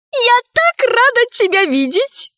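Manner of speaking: gushing in a very high voice; high-voiced, overjoyed greeting; delighted exclamation. A narrator's reading. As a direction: pour out joy at seeing a dear friend. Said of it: "воскликнула Афродита".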